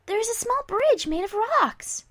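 bright, high and cheery; cheerful. Acted. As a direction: chirp it out happily. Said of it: "replied cheerily".

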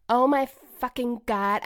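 ordinary talk, nothing marked

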